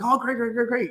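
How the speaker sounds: high-pitched eager chatter; fired up. Retold or imitated. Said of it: "I'm all fired up".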